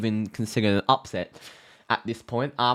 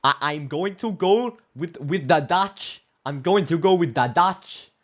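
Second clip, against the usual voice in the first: mock Dutch accent, higher and slowed; 6 semitones higher, 5 dB louder, slower than usual. Shown as a demonstration. Football pundit trying his Dutch accent on the prediction, mock Dutch accent. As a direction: try on a Dutch accent for the pick. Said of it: "How's the Dutch accent go?".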